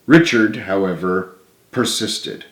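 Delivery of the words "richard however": usual speaking voice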